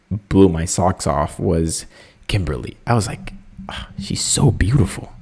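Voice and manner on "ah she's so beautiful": breathless whisper. Retold or imitated. Swooning over a contestant.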